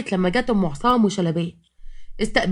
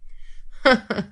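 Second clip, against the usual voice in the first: acted chuckle; 3 semitones higher, 3 dB quieter than usual. Loza answering her rival's accusation, chuckling.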